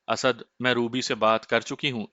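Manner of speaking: plain reading voice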